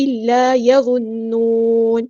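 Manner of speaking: slow, drawn-out Quranic recitation; Tajweed recitation. Shown as a demonstration. Teacher models the verse word, slow Tajweed recitation. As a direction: recite slowly, holding each long vowel.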